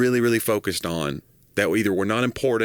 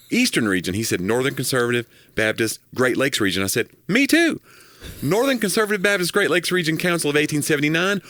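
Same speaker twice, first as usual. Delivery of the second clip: building, ever higher and more excited joke delivery; joke telling; 5 semitones higher than usual. Acted. Reading an Emo Phillips joke to his cohost, escalating pitch. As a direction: build each me too higher till the punchline. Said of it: "I'm not gonna read it in his voice".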